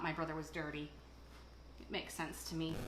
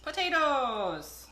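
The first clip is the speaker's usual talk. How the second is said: grand reveal; 5 semitones higher, 8 dB louder, much slower than usual. Acted. Grand reveal of the main ingredient.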